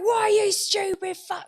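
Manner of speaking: high, loud mock Scottish trucker insult; bad Scottish accent. Mimicking the angry Scottish truck driver in a bad Scottish accent. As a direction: have a go at a Scottish accent and miss. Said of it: "I can't do a Scottish".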